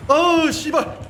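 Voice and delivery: sharp loud high curse; frustrated cursing. Real, not acted. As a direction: let the swear burst out. Frustrated with the game.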